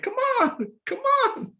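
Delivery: put-on high, strained old man urging; straining old man. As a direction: coax it out like a frail old man.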